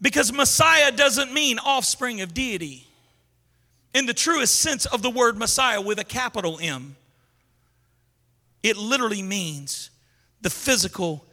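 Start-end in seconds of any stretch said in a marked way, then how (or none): none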